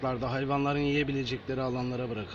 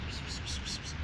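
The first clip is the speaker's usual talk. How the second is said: whispered cat call; 7 dB quieter than usual. Acted. Calling cats softly.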